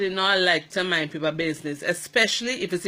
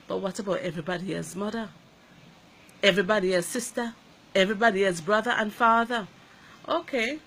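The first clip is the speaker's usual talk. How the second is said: normal talking voice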